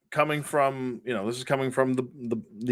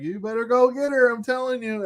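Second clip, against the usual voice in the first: urging friend; 11 semitones higher than usual. Retold or imitated. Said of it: "And then he's like".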